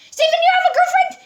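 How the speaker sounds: very high, loud, fast squeal; excited squeal. Acted. Pearl jumping at Sans over his girlfriend, excited squeal. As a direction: squeal it while bouncing at him. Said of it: "jumping at Sans excitedly".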